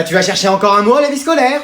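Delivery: ordinary talk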